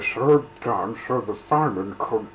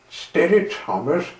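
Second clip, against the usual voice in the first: calling out; 8 semitones higher, 6 dB louder than usual. Acted. Driver calms an eager engine.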